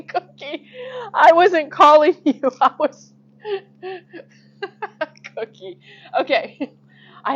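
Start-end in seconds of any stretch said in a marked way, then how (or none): 0.1-3.1 loud laugh-filled call to the dog; loud, amused aside to a pet dog. Real, not acted. Talking to dog Cookie. 3.4-5.8 laughing. Real, not acted. Laughing at the dog.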